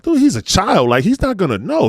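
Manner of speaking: mock wife telling him to ease up, pitched well up; exasperated wife. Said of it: "my wife would be like".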